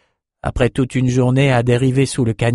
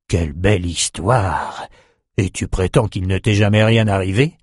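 put-on old sorcerer voice; 3 semitones lower than usual. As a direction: speak as a kindly old mage.

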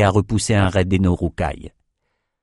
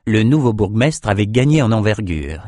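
plain reading voice

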